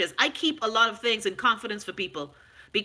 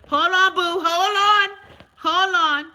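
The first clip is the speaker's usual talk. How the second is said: calling out; 8 semitones higher, 6 dB louder, slower than usual. Real, not acted. Calling out to someone off mic.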